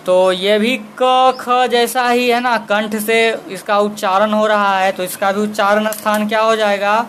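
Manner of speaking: ordinary talk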